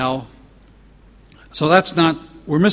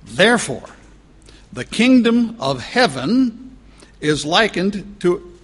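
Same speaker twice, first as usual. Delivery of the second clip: high, slow, weighty reading of the verse; solemn scripture reading; 5 semitones higher, 4 dB louder, slower than usual. Acted. Preacher reading the parable to his congregation, slow and solemn. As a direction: lift each word of the verse slowly.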